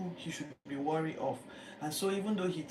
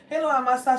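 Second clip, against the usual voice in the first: bright, loud cousinly hello; mock friendly greeting; 8 semitones higher, 11 dB louder than usual. Joab greeting his cousin Amasa, loud and friendly. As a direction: hail him like family, all smiles. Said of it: "greeting him like they're cousins".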